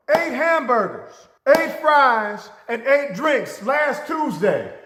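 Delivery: loud, high, insistent rant; indignant complaint. Retold or imitated. Customer to manager listing the shorted order, loud and insistent. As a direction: list every item like you've been robbed. Customer repeats his demand.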